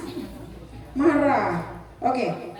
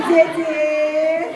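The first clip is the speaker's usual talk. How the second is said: drawn-out, louder teasing coo; playful teasing; 2 semitones higher, 5 dB louder than usual. Acted. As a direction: tease a happy child with a stretched-out call. Models teasing a happy child. (menggoda dengan riang)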